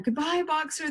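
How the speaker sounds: high, sing-out farewell; high chorus farewell at modest volume. A narrator's reading. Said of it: "they chorused".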